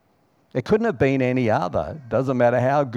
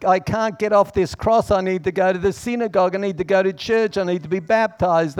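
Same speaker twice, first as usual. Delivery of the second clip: higher, anxious protesting thief; panicky excuses; 6 semitones higher, 3 dB louder than usual. Pretend. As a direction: babble excuses higher, getting faster.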